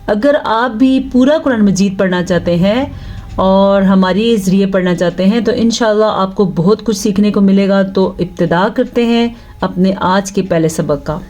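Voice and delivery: normal talking voice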